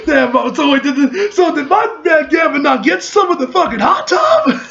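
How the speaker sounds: high, loud, laughing disbelief; excited shout. Real, not acted. Streamer shouts in excited disbelief at the hot tub. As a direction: yell it out half-laughing in disbelief. Excited reaction to game scene.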